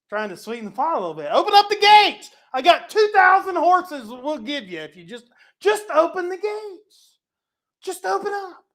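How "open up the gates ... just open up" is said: high, loud wheedling demand; mock envoy pushing the city to surrender for horses; pushy envoy. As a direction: lean on them to open the gates. Assyrian bribing, demanding gates open.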